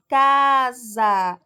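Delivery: "Casa" loud, with the first syllable CA drawn out; sílaba tônica destacada. Shown as a demonstration. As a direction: lean hard on the CA of "Casa". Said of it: "O K se destaca mais". ("Casa" com o CA forte e alongado)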